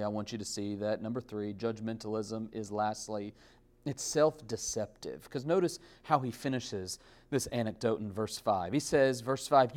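usual speaking voice

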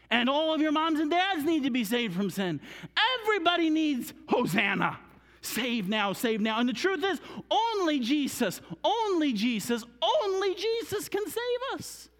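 high, loud, impassioned proclamation; full-force preaching. Real, not acted. Preacher telling children and parents only Jesus saves, shouted high. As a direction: cry it out to the whole room.

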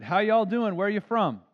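a hint of a chatty Southern greeter; friendly old Southerner.

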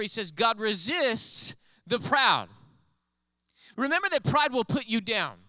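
high, forceful sermon delivery; emphatic preacher, stretching key words; preaching. Real, not acted. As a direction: raise the voice and drive the warning home. Preaches with raised voice.

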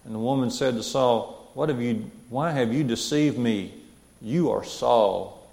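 measured, earnest Bible reading aloud; steady public reading of scripture. Acted. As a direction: read the passage to the congregation.

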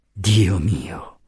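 weary, sighing lament; subdued, regretful exclamation; deep sigh. A narrator's reading. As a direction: sigh it out heavily. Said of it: "immettendo un profondo sospiro".

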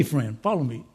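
ordinary talk, nothing marked

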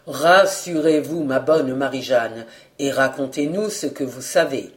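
low, soothing, benevolent voice; kindly reassurance. A narrator's reading. Journalist calming the flustered cook, very kindly. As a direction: settle her down kindly, low and unhurried at first. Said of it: "avec un accent de grande bienveillance".

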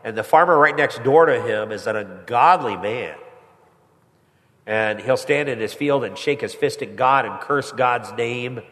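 ordinary talk

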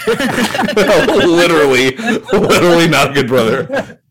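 words through real laughter; stretched out, cracking up; laughing while agreeing. Real, not acted.

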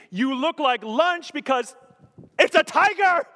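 high, loud incredulous punchline; loud exasperation. Acted. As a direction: shout the obvious at a fool. Mocking the tiger owner's surprise.

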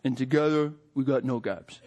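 a lightly voiced Rocky; Rocky quote. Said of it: "and he says".

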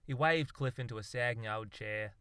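ordinary delivery

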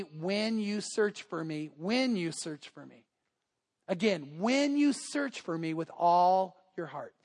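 slow, deliberate preacher's repetition; solemn emphasis. Real, not acted. Preacher repeats verse for solemn emphasis.

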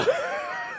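high whooping laugh at his own joke. Real, not acted. Punchline of his recovery-room story.

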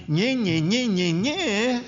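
high, whiny nye-nye taunt; mock sing-song nagging; mocking nagging wife. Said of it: "If she says to me".

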